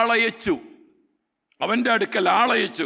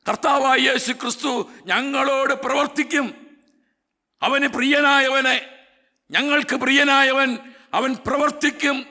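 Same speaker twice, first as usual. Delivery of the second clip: higher-pitched, insistent sermon delivery; impassioned preaching; 5 semitones higher than usual. Acted. Preacher voicing the sisters' faith that Jesus will act, impassioned. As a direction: preach it high and urgent, driving each point home.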